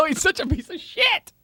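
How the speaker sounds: high, slow, hammed-up yell; mock shout. Cohost joking about a friend, mock shout. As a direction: bellow the mock insult. Mock insult amid laughter.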